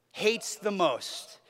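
normal talking voice

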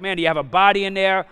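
loud retold heckle from onlookers; yelled out. Onlookers teasing the cooler carriers, yelled out. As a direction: call it out like a joking bystander. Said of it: "they're yelling these things out".

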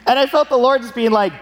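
normal talking voice

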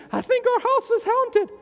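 high, slow mock-frightened whine; mock scared member. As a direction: play a spooked believer. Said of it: "I've had people throughout my years".

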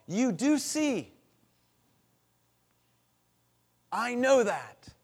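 loud, high and slow declaration; fervent high cry. Acted. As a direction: proclaim it up to God, drawn out. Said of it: "David cries out to God".

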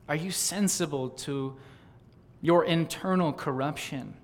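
soft, searching questions with long pauses; hushed appeal. Real, not acted. Preacher asking friends to examine themselves, hushed.

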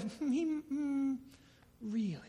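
slow, soft skeptical hum; doubting hmm. Acted. Preacher imitating Satan's doubt, slow and soft. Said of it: "That's what Satan's really, really good at".

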